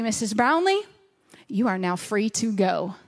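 low, formal official's pronouncement; mock-solemn officer. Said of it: "they said the words to me".

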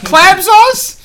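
squeaky high laughing question; laughing disbelief. Real, not acted. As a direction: crack up in disbelief.

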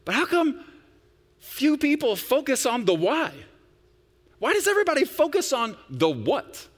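high, loud preaching question; fired-up question. Real, not acted. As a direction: lift the congregation with the question.

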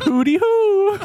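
silly clown. Acted. Laughing silly exclamation.

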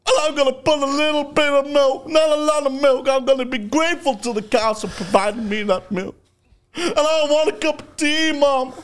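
put-on high-pitched whining little boy; loud whiny kid pleading for tea; whiny child voice. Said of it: "I'd go".

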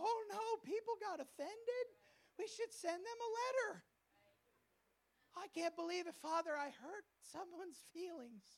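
high, fussy panicked voice, mocking; mock fretful leader. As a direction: play a nervous leader wringing his hands. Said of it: "And he doesn't go".

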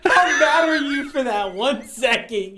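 high, loud, teasing through laughter; laughing hard. Real, not acted. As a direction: crack up while asking the question. Cracking up at friend's reaction.

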